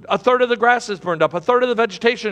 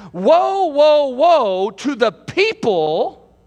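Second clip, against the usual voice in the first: crying woe; 7 semitones higher, 4 dB louder, much slower than usual. Acted. Preacher cries out woe.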